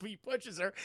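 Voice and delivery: normal talking voice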